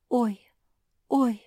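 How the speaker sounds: high, loud little yelps of alarm; frightened. A narrator's reading. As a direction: yelp as if startled. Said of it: "испугалась Луиза".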